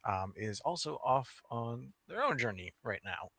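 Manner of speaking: usual speaking voice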